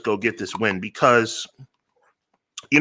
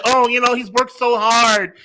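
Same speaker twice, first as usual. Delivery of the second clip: mocking imitation; 10 semitones higher than usual. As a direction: mimic a gushing sympathizer with scorn. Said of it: "You know why I don't talk like that?".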